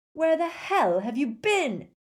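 loud, high, slow angry shout. Acted. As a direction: yell at your friends in relief and fury. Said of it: "Harry shouted".

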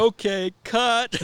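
high, loud call to cut; director calling cut. Pretend. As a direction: shout it like a film director. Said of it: "You hear".

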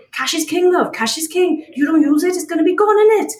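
put-on high-pitched Welsh woman's accent. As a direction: lay on a rough Welsh lilt. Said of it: "I'm sorry about the accent".

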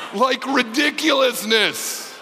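slow, amused and laughing; drawn-out amused comment; said through laughter. Real, not acted. Laughing at the story.

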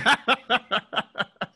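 real high-pitched chuckling; amused laugh. Real, not acted. Guest laughs after confessing he hates snow, amused. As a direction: burst of helpless high laughter.

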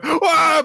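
high, loud "Wah!" cry; little girl wailing in dismay; shocked wail. Retold or imitated. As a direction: wail it clutching your head. Anya same shocked reaction.

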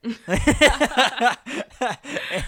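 big high burst of laughter; loud laugh. Real, not acted. Laughing at own story.

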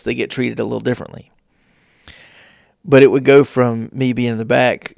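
ordinary talk

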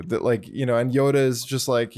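usual speaking voice

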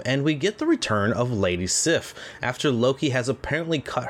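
steady scripted recap read; recap narration. Acted. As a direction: read the plot summary like a host. Podcast host reads episode recap.

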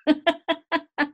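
high burst of laughter; loud laugh. Real, not acted. Laughing at punchline again.

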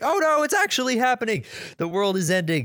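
high-pitched imitation of panicking babies; mock freaking out. Babies fearing the world is ending, high mock panic. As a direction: squeal it in put-on panic, without shouting. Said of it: "The babies are freaking out".

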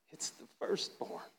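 whispered, awed answer; hushed reply. Acted. God whispering his answer to the archangels. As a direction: breathe it out like a holy secret. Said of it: "He goes".

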